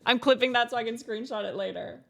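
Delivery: ordinary talk, nothing marked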